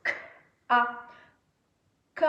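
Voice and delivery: K, then A, blended into a long kaa; bare k, separate A, then join them as kaa; syllable sounded out. Shown as a demonstration. Teacher reads the first syllable, K and A apart then blended. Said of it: "c'est la première partie du mot".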